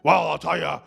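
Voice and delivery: a light Cookie Monster voice ranting conspiracies; mildly gruff monster voice; Cookie Monster pundit. Retold or imitated. Comic imitates the pundit ranting on his podcast, lightly monstered. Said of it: "a guy who sounds like that".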